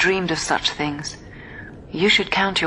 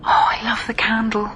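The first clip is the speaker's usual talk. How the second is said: higher, whispered wonder; hushed delight; 4 semitones higher than usual. Acted. Jenna marvelling at the candle on her cake. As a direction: breathe it out in awe.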